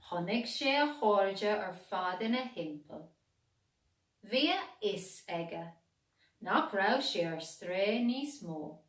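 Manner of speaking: plain reading voice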